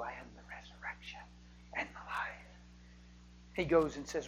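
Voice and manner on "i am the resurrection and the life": soft whispered promise; tender whisper. Acted. Jesus quietly promising life to Mary, whispered. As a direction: whisper it gently to the mourner. Jesus answering the grieving sister.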